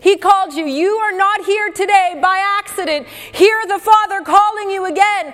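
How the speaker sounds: rising revival preaching; preaching at full force. Acted. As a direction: drive it home to the congregation. Preaching builds up.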